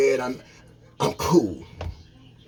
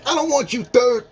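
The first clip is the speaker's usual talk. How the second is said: pitched up and pushing hard; heated rant; 9 semitones higher than usual. Real, not acted. Ranting at third-party gossipers, heated. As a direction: call out the gossipers, fired up.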